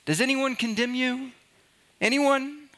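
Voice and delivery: high-pitched, searching question; questioning Jesus. Acted. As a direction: ask it high, voicing Jesus to the accused woman. Voicing Jesus' question to the woman.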